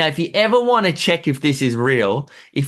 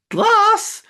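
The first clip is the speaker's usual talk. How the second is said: glass squealed out much higher and louder; excited word for a dog; 4 dB louder than usual. Shown as a demonstration. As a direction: make the dog perk up at glass. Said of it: "with the right excitement".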